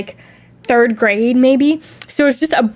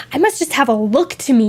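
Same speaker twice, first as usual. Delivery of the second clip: ordinary talk, nothing marked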